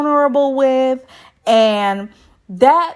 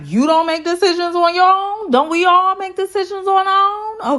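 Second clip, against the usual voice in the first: indignant; 9 semitones higher than usual. Retold or imitated. Retelling Zach's indignant quote.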